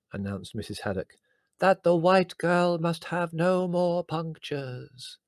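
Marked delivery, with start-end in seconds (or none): 1.6-5.2 put-on a slow, high Indian accent for the spirit guide. Medium channelling her spirit guide Mawake in a slow Indian accent. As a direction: stretch each word out in a put-on Indian accent. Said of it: "strong Indian accent".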